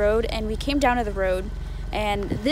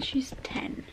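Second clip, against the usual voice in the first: dry, unvoiced whisper; whispered aside; 11 dB quieter than usual. Real, not acted. Sister correcting the camera, dry whisper. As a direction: whisper it dryly behind her back.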